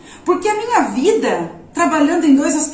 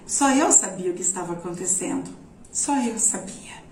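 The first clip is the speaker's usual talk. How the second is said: hushed, moved; 7 semitones lower, 7 dB quieter than usual. Real, not acted. Moved, recalling the secret.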